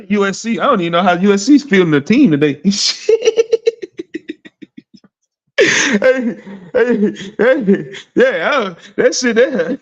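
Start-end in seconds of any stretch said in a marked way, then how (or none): 2.6-4.3 sudden high laugh bursting out; burst of laughter. Real, not acted. Host laughing at Louisville beating USC, a high burst of laughter. 5.6-9.8 words tumbling out through laughter; laughing. Real, not acted. Host reacting to the score, laughing through his words.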